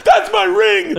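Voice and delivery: high, loud retold cry of joy; overjoyed hollering; amazed shout. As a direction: yell it in astonished delight. Ring owner's retold amazed reaction.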